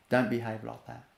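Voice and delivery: calm and firm. Shown as a demonstration. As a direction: stop the child quietly without heat. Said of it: "Calm and firm".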